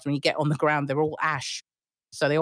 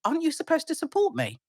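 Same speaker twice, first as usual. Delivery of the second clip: incredulous; 12 semitones higher than usual. Retold or imitated. And you're like.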